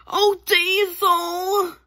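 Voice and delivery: high, slow delighted cry; excited drawn-out call. Acted. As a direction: stretch it out, thrilled. Character greeting loudly.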